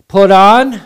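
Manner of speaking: emphatic call. Real, not acted. Preacher's emphatic command.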